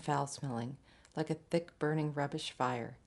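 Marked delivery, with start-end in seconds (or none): none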